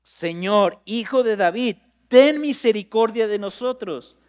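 loud pleading cry, slow on the last words; desperate loud plea for mercy. Acted. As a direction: cry out to be heard over a crowd. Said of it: "empiezan a gritar".

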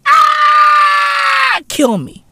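high shriek then kill me; mock anguished scream. Vlogger screaming in fake despair at the name.

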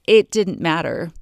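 usual speaking voice

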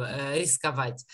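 ordinary talk, nothing marked

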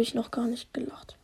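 whispered aside to the audience; whispering. Real, not acted. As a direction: breathe it as a secret aside. Aside to viewers.

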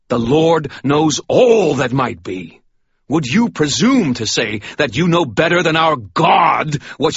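booming righteous rebuke; stern sermon. Acted. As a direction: lay down the truth to the fallen man. Michael's furious sermon.

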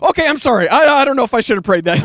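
high, loud, flustered backpedalling; startled apology. Retold or imitated. Retelling his shocked apology to God, flustered. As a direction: blurt a panicky comic apology.

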